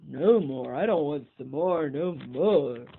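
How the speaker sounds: loud frightened pleading; loud panicked bear wail; terrified cry. Acted. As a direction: beg the monster to stop. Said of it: "The terrified bear cried out".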